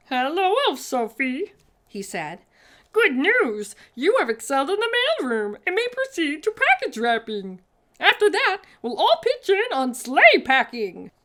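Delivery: high, loud, quick elf announcing news; cheery elf. A narrator's reading. Mister Pudding telling Sophie her promotion, high and loud. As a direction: bubble over with good news.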